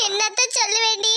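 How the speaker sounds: tearful, helpless sob; crying. Acted. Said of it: "ஏன் ஆத்தா அழுற". (அழுகையுடன்)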